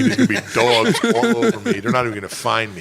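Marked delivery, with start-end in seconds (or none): 0.0-1.8 laughing. Real, not acted. Joking about crashing with dogs.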